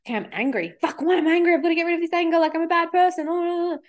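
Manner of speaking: spiral into mock panic over feeling angry; panicked inner voice. Panicked inner voice about anger.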